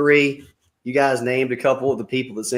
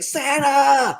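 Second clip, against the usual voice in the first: high, breathless awe; starstruck Santa; 14 semitones higher than usual. Retold or imitated. Buddy gazing at Santa, high starstruck sigh. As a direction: sigh the name in wonder. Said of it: "He's like".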